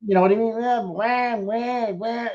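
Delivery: high mocking whine; whiny voice. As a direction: whine like a sore loser. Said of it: "that whiny ass voice and going".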